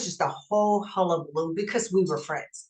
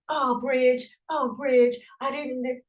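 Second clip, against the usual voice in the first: higher, whiny mock plea; higher, hammed-up pleading of a cornered coworker; pleading guy backing down; 7 semitones higher, slower than usual. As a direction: imitate a big man caving and begging. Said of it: "he's backing down".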